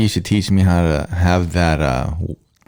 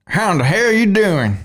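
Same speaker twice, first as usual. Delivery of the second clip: Texan accent; 10 semitones higher than usual. Shown as a demonstration. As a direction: copy the Texan holler.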